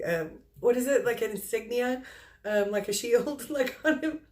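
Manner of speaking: ordinary talk, nothing marked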